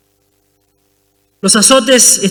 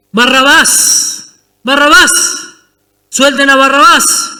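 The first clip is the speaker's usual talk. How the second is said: raised, high chant of the mob; crowd shouting; 4 semitones higher, slower than usual. Acted.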